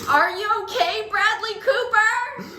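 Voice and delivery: high, full-voiced shout across a plane; yelling. Yelling to first class from back of plane, loud shout. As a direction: yell it out, stretching the name. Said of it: "I just yell from".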